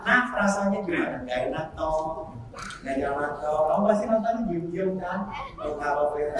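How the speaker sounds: rendah, pelan, tidak menghakimi; menerima dengan tenang. Retold or imitated. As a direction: ajak anak bicara dengan suara lunak dan rendah. Orang tua berdialog tanpa menyalahkan anak. (lembut dan menerima tanpa menyalahkan)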